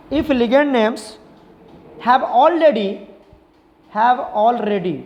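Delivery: slow, drawn-out dictation pace for notes. Shown as a demonstration. Teacher dictating a rule to students, slow and drawn out. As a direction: spell out the rule so students can write it.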